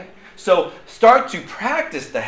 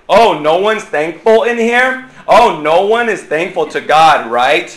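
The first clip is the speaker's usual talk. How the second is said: loud, mock-disappointed sarcasm; sarcastic guilt trip; 7 dB louder than usual. Shown as a demonstration. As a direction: scold the room sarcastically, loud and drawn out. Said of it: "That's my sarcastic way".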